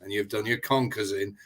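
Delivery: ordinary delivery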